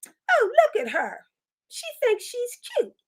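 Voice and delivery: high, mocking gossip voice; catty girlfriends. Girlfriends mocking the milkmaid at the party, high and catty. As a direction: sneer it like jealous girlfriends. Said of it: "they're gonna say".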